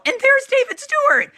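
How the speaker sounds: excited. Real, not acted. Excited retelling of finding him.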